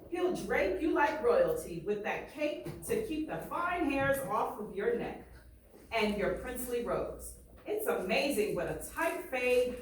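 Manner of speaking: lively read-aloud, painting each picture; story reading. Acted. Teacher reading a barbershop story aloud to students, lively. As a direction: read it to the class with swagger and pride. Reads the book aloud to class.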